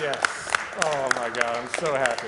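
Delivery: loud, joyful whoop of relief; excited cheer. Real, not acted. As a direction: celebrate the clean skip. Skip just landed, cheering.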